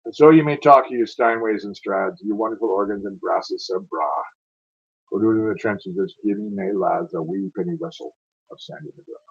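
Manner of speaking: rolling, dramatic ballad reading; a Scots war poem read aloud with feeling; poem recitation. Acted.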